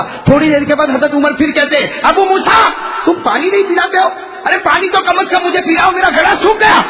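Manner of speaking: urgent preacher's retelling; impassioned preaching. Acted. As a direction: lift the pitch and press the story on the crowd. Preaches the story with raised voice.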